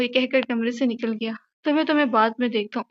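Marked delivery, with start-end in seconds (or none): none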